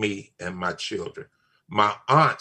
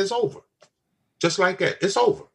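a raised, firm retelling of the warning; firm retold warning; 7 semitones higher than usual. Father to daughter's friend, firm retold warning. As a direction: lay down the law again, a little higher.

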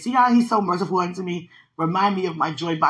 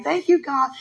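higher, louder mock-childlike thanks; acted grateful turnaround; 8 semitones higher, 5 dB louder than usual. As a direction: imitate sudden gratitude after a tantrum. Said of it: "to like".

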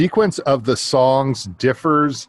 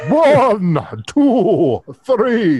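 high, loud dramatic counting; 9 semitones higher, 6 dB louder, much slower than usual. Shown as a demonstration. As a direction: count like a theatrical vampire. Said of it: "should have counted like the count".

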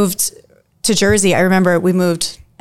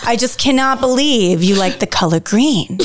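put-on Brooklyn accent; 4 semitones higher than usual. Daughter imitating her mom's Brooklyn accent on the podcast. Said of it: "she has, like, a Brooklyn accent".

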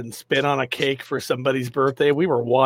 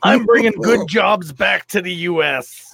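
a hint of a raspy pitchman voice; lightly voiced infomercial pillow salesman; Mike Lindell; 3 semitones higher, 4 dB louder than usual. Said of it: "it just sounds like Mike Lindell".

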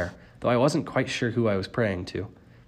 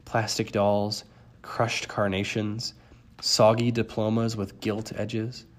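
ordinary delivery, nothing marked

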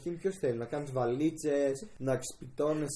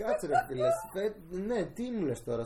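laughing disbelief; 6 semitones higher, 3 dB louder than usual. Retold or imitated. Laughing disbelief at retold reply.

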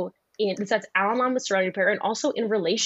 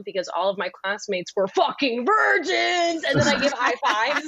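mock crying shout; 5 semitones higher than usual. Comic telling a joke to a podcast host, mock crying. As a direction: wail it like a fake tragedy, then shout. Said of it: "like, a little serious".

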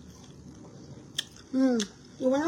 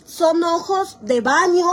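ordinary talk, nothing marked

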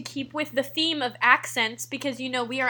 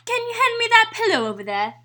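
put-on higher, louder British accent; 9 semitones higher, 5 dB louder than usual. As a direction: play it posh British, lifted pitch. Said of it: "British? / Yep".

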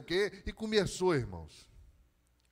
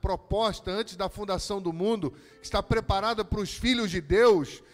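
slow, then louder preaching; preaching climax; 5 semitones higher, 9 dB louder than usual. Acted. Pastor urging the congregation to endure, building louder. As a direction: drive it home slowly, building.

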